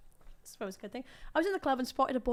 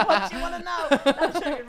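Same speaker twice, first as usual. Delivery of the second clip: laughing; 3 semitones higher, 11 dB louder than usual. Real, not acted. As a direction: crack up while talking. Joking with co-hosts, laughing.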